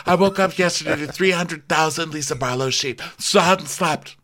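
high-pitched put-on complaining voice; high, whiny impression of a sleepless woman; acted sleepless monologue. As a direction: keep up a hammed-up high voice throughout. Continues Lisa's sleepless monologue in character.

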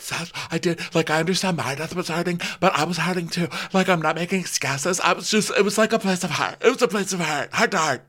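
high-pitched imitation, making excuses; hammed-up high, defensive voice; put-on pleading. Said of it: "And she's like".

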